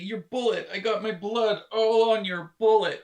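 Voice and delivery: panicked but endlessly polite, apologizing to the shooter; over-apologetic shot Canadian. Acted. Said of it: "this would be our reaction".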